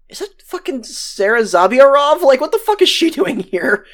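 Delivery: high-pitched incredulous questioning; mock bewildered outburst, pitched well up; retold disbelief. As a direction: imitate someone startled by who they see. Retold startled sighting of Sarah.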